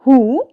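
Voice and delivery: हु, w बोले बिना; हु को बिना व के साफ़ बोलें; silent w. Shown as a demonstration. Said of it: "डब्ल्यू जो हमारी है वो हमेशा साइलेंट". (हु, डब्ल्यू silent)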